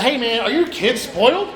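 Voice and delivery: ordinary talk, nothing marked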